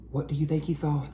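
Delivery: usual speaking voice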